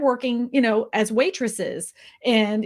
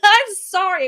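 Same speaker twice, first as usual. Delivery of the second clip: high, loud laughing apology; 10 semitones higher, 7 dB louder, slower than usual. Real, not acted. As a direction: apologize through real laughter. Laughing apology after her own rant.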